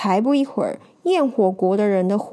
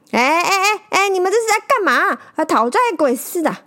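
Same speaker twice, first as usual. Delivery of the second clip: higher, louder irritated snap; impatient; 7 semitones higher, 3 dB louder than usual. A narrator's reading. Traveller shoos off the crowd, impatient and loud. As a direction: shoo them off, fed up. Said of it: "不耐烦地说".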